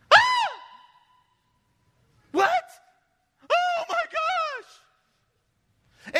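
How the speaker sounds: high, loud panicked outbursts; mock freak-out. Preacher showing people who react to bad news, freaking out. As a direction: overreact with shrieked disbelief. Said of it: "Instead of freaking out".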